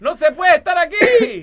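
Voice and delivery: put-on very high, loud, slow child's whine; whiny kid. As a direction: complain like a shivering kid. Kid complaining at cold lake.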